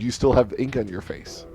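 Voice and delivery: ordinary delivery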